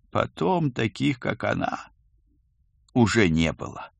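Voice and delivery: quiet, understated sorrow; a ghost sadly summing up, trailing softly. A narrator's reading. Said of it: "печально подытожил призрак". (печально, негромко, со сдержанной грустью)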